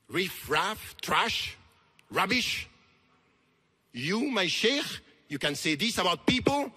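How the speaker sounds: loud, punched words with pauses between; rising, incredulous challenge; indignant rebuke. Real, not acted. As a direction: throw the insults back in outraged disbelief. Indignant rebuke of his sheikh.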